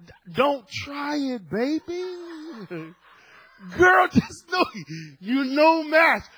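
hammed-up slow warning; mock warning to wife. Husband blocking his wife from the crib, hammed-up loud slow warning.

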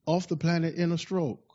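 usual speaking voice

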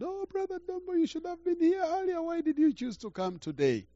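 high, loud excited cry of the wife; excited yell. Retold or imitated. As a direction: shout it up high, overjoyed to see him. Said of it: "She yelled".

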